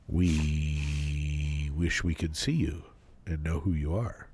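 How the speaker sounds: slow, low, creepy murmur. Pretend. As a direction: say it like a stalker, slowly. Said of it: "That was slightly creepy".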